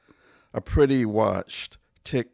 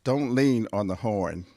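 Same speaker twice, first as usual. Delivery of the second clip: ordinary talk, nothing marked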